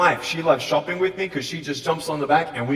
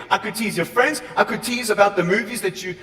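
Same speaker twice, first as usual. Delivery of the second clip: ordinary talk, nothing marked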